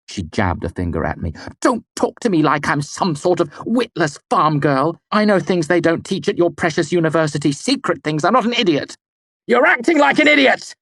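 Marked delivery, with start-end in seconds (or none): 1.6-9.0 high, loud indignation; angry. A narrator's reading. Denna angry. 9.5-10.7 high, very loud shout. A narrator's reading. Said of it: "I shouted so loudly".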